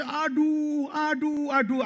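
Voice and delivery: high moaning ouch ouch; mock cries of pain. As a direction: groan like a hurt man. Injured hang-glider crying out. (orang terluka mengerang tinggi)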